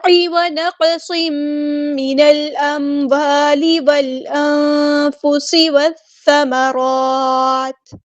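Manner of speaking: slow, drawn-out melodic tajweed recitation; Quran recitation. Shown as a demonstration. Teacher recites the verse for students, slow melodic chant. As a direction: chant each word slowly in tajweed.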